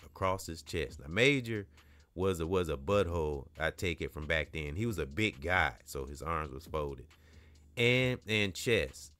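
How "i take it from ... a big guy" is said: ordinary delivery, nothing marked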